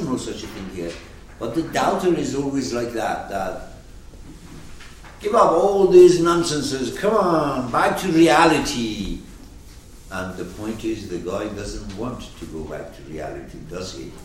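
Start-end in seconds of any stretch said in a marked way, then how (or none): 5.2-9.2 raised, higher voice of exasperated challenge; doubter's loud scolding. Acted. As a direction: call him back loudly, pitched up. Acted doubter scolding the believer.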